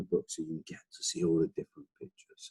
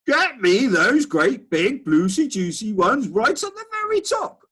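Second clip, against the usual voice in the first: put-on much higher, louder bossy old man; Duke shouting; 7 dB louder than usual. Old Duke shouting at his gardener, much higher and louder. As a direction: bark orders like a spoiled old duke. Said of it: "the old man was shouting".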